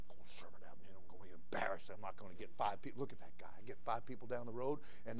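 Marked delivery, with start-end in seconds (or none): 0.1-3.0 hushed, low fretting disciple; quietly embarrassed disciple muttering; worried disciple. Retold or imitated. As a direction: mutter it like a nervous helper.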